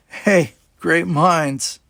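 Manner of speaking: cheeky, drawn-out ribbing; playful quip. A narrator's reading. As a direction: tease her with a drawn-out joke. Dal jokes nudging her.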